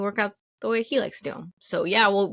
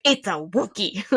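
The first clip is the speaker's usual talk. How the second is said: slow, deliberate correction, spelled out for her; exasperated guy. Retold or imitated. The guy slowly corrects the girlfriend about the Wookiee, patient and exasperated. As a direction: spell it out slowly like it's obvious. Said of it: "the guy's like".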